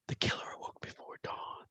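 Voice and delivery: faint unvoiced whisper. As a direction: whisper it like a hidden voice under the song. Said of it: "There's a whisper and then Jim".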